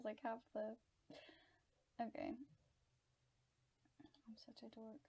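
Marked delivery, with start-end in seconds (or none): 4.3-5.0 near-whisper to yourself; very soft, breath-only aside; self-mocking mutter. Real, not acted. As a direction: chide yourself under your breath. Chides herself under her breath.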